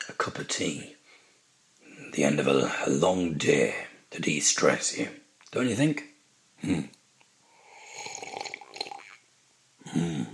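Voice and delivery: very soft, unhurried role play with long pauses; intimate ASMR murmur. Shown as a demonstration. Host sharing a cup of tea with listener, intimate murmur. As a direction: murmur close to the ear, sipping contentedly. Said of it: "So I'm just gonna do maybe thirty seconds".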